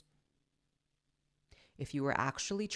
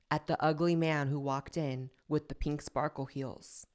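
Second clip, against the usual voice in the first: usual speaking voice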